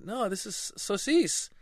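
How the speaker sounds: put-on high childish protest; protesting kid. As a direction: plead like a small boy. Child insists on word.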